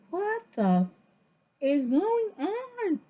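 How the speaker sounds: high, slowing incredulous question; mock shocked outburst; stunned. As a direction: drag out the disbelief. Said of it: "I'm just looking like".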